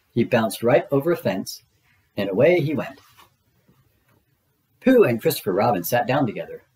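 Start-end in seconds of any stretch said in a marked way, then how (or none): none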